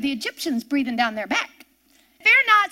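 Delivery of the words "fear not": loud, high command; a bold call of Fear not; commanding cry. Acted. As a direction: call it out to calm a crowd. Preacher quoting Moses' command again.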